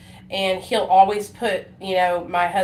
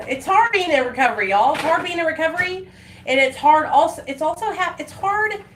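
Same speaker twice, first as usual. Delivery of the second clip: pushing through rising emotion, faster; emotional; 8 semitones higher, 4 dB louder than usual. Real, not acted. Telling viewers how hard recovery is, emotional and rising. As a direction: voice rising higher and louder as feeling builds. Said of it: "I'm getting emotional".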